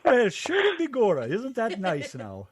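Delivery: high, slow stage-Irish lilt; mock Irish accent. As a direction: play up a jokey Irish brogue. Irish accent talk, mock Irish phrase.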